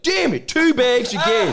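high, loud, laughing mock despair; laughing high-pitched mock complaint; mock frustration. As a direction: squeal it out like a defeated friend. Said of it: "be like".

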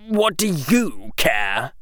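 put-on slow, dismissive young boy; Draco voice. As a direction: shrug off the concern.

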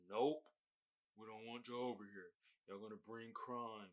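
a hint of smug border officials turning people away; mock officials. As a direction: mutter the rejection like a smug official. Mocking America's stance on Haitians.

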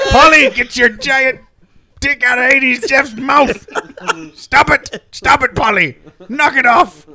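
loud, high laughing pirate shouting; a rowdy pirate yelling at his parrot; pirate captain. Pretend.

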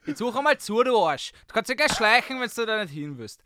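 loud, grumpy Viennese dialect scolding; rude Viennese complaints officer. Pretend. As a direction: tell off the tourist like a true Viennese grouch. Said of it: "like a true Viennese complaint officer".

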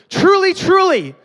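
both TRULY high and loud, slow; double emphatic. Acted. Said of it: "It's a double emphatic".

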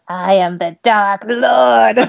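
slow, small squeaky demon voice; a tiny demon trying to sound scary. Retold or imitated. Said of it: "his voice is all tiny even".

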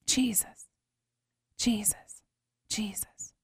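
whispered prayer fading to nothing; desperate whispered prayer. Retold or imitated.

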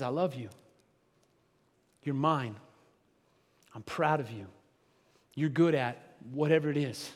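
soft, low, tender reassurance; hushed fatherly tenderness. Pretend. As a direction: speak it gently like a loving dad. Loving father speaking to child.

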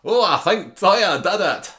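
high, loud, drawn-out Weatherfield accent; Weatherfield twang. Shown as a demonstration. Showing off to co-host, hammed-up Weatherfield twang. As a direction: ham up a Coronation Street northern twang. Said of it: "I can do Weatherfield twang".

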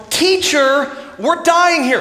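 high, loud desperate plea; frightened cry. Acted. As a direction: call out to the teacher in panic. Disciples crying to Jesus.

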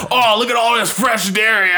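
high, loud goofy Waterboy voice. Retold or imitated. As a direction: ham up a dim, excited Waterboy voice. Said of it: "He's like a fucking water boy".

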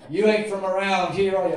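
a mock Southern local grinning at an outsider; laughing Southern drawl. Preacher mocks the clannish Southern family, laughing in a drawl. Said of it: "the accent".

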